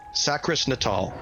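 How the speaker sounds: ordinary delivery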